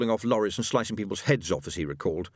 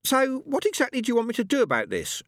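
lightly voiced Madame Tracy, much higher; a higher, prim lady's voice; high woman's voice. A narrator's reading. Madame Tracy questioning a man, higher lady's voice.

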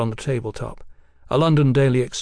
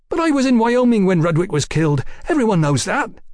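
high, loud indignant protest; 9 semitones higher, 4 dB louder than usual. Acted. Accused Mallory protests to Fraser that he was away. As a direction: push back hard against the accusation.

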